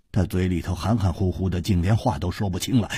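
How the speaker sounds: usual speaking voice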